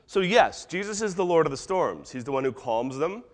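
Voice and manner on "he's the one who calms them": ordinary talk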